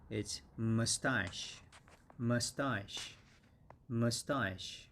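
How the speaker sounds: mustache said slowly, second syllable stressed, long ah then sh; teacher drills mustache slowly, stress on the second syllable. Shown as a demonstration. Teacher models mustache for students, stressing the second syllable. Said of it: "the accent is on the second syllable".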